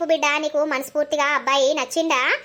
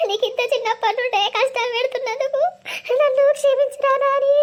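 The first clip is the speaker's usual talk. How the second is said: sobbing apology; 8 semitones higher than usual. Acted. Mother sobbing as she asks her son's forgiveness. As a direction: break down begging forgiveness. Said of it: "నువ్వు ఎందుకు ఎడతానవే?".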